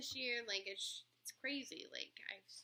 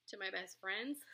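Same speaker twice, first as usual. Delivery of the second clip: normal talking voice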